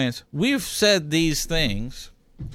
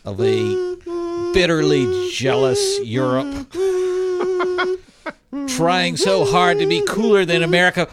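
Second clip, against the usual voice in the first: rising, soaring, louder and higher to the end; patriotic speech; 11 semitones higher, 4 dB louder, slower than usual. Pretend. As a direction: build it like a tearful Fourth of July oration. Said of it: "Are you ready for the big speech?".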